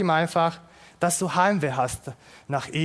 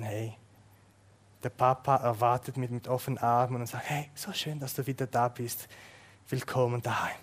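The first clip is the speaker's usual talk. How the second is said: soft, low and hushed fatherly welcome; whisper-soft, arms wide open; tender welcome; 5 semitones lower, 7 dB quieter than usual. Pretend. Vater empfängt heimkehrenden Sohn. (leise, zärtliche Begrüßung des Vaters)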